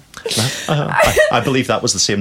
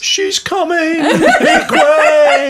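high, slow, loud heralding call; mock announcer; 5 dB louder, much slower than usual. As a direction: clear the path, she's coming. Mock announcing friend's buffet rush.

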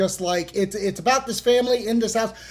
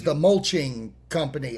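usual speaking voice

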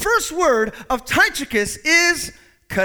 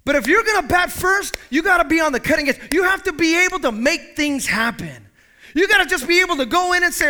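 preaching; 3 semitones higher, 5 dB louder than usual. Acted. Pastor to the church, preaching at full force. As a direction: preach it out to the congregation.

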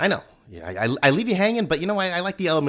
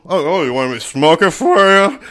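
sarcastic; 4 semitones higher, 10 dB louder than usual. Acted. Annoyed smoker retort, acted.

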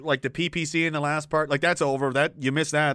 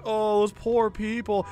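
slow, syrupy fake sympathy, a bit higher; mock pity; 5 semitones higher, slower than usual. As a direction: drag it out like mocking pity. Mocking staged sympathy.

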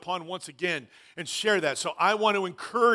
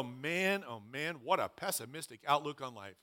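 mock dismay; 5 semitones lower, 11 dB quieter than usual. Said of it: "You go".

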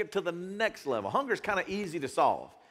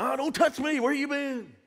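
high, scornful brush-off; dismissive bystander; 8 semitones higher than usual. Retold or imitated. Preacher mimics people refusing the hungry man, high and scornful. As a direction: push the hungry man away, sneering. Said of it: "Everybody's like".

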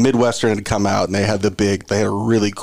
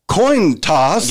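"Coin toss" drawn out, higher, each word set apart; careful over-pronunciation; 6 semitones higher than usual. Retold or imitated. Teammates recall the announcer stuck on it, laboured "Coin toss". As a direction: say "Coin toss" slowly and deliberately, pitched up.

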